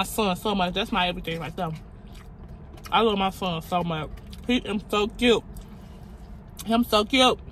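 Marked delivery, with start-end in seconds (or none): none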